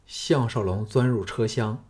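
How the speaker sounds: plain reading voice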